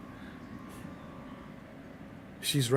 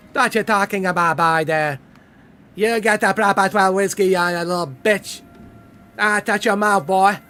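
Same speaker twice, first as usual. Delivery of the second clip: loud, higher bossy tough-guy voice; mock tough guy; 5 semitones higher, 6 dB louder than usual. Streamer hamming a bossy hard man, loud and high. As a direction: bark the order like a swaggering hard man.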